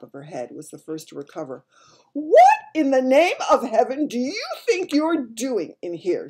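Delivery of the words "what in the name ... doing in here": high, loud outraged demand; angry shout. A narrator's reading. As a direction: shout it as a furious scolding. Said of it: "she shouted angrily to Sophie".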